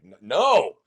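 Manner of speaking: sharp, loud refusal; defiant no. Retold or imitated. Refusing to be told what to do, loud no. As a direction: bark a stuttered no. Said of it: "I'm like".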